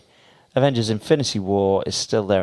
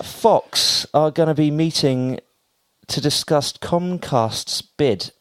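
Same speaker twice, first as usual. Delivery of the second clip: slow, raised, drawn-out newsy delivery; slow dramatic read; 5 semitones higher, slower than usual. Acted. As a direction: read it like a big announcement. Slow announcer-like news read.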